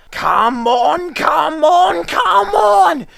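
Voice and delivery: building to a very high cross shout; straining engine yelling at trucks; puffed crossly. Acted. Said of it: "puffed James crossly".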